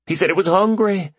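high, eager report; building towards the miracle; exhilarated. Acted. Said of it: "His voice was choked but exhilarated".

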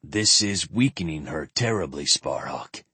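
grave. A narrator's reading. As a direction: speak with understated gravity. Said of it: "Kirik continued gravely".

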